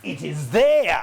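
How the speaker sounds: slow, drawn-out mock French accent; bad French accent. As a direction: imitate a French professor, badly, drawing it out. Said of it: "Apologies for the bad French accent".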